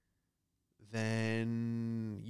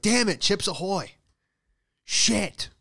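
higher, louder mock frustration; exasperated outburst; 8 semitones higher, 6 dB louder, slower than usual. Comic rant about getting home with the wrong snack, exasperated. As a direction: curse it out in hammed-up annoyance. Acted annoyance at wrong purchase.

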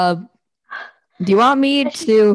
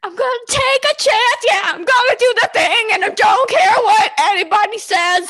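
high excited shouting; 13 semitones higher than usual. Acted. Shouting a silly copypasta line.

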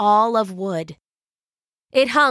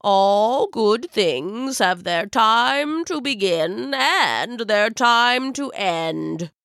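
put-on solemn old wizard; slower than usual. Wizard passes judgment.

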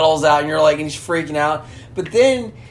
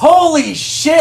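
high, loud, drawn-out exclamation; real elated outcry; excited relief; 10 semitones higher, 6 dB louder than usual. Real, not acted. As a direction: let the relief burst out. Relief at remembering the name.

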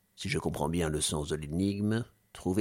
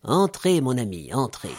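welcoming; 5 semitones higher, 5 dB louder than usual. Acted. Welcoming host calls in.

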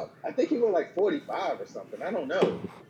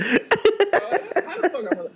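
high, slow, laughing exasperation; laughing disbelief; 7 dB louder, much slower than usual. Real, not acted.

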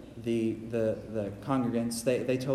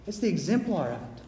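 usual speaking voice

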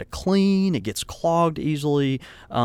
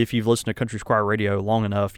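ordinary talk

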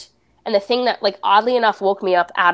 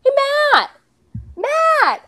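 high-pitched mock yell at normal volume; reenacted calling out, pitched way up; retold yell; much slower than usual. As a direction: call a name out high, as if to someone out of earshot. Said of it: "I, I yell out".

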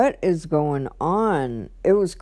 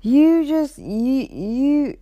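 stammering mock fury; mock sputtering rage; 6 semitones higher, slower than usual. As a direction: imitate him too angry to finish. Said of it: "He just went off".

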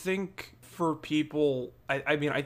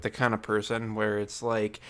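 usual speaking voice

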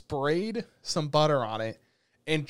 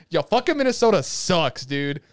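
rant; 3 semitones higher, 7 dB louder than usual. Real, not acted. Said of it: "I'm gonna rant".

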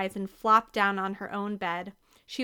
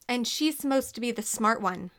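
ordinary delivery

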